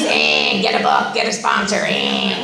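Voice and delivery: put-on very low, grumbling old grouch; mean old-timer. Mocking the mean old members at meetings, low and grouchy. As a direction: grumble it at the bottom of your voice. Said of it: "this mean old alcoholic man".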